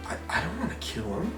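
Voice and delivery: timid quiet reply. Retold or imitated. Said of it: "Kronk's like".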